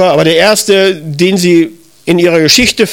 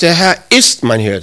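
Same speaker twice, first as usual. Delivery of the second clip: "ist" emphasized as self-assurance; stress on IST. Shown as a demonstration. Believer affirms against his feelings, stress on "ist". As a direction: reassure yourself by pressing on "ist". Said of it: "Das ist so eine Bekräftigung".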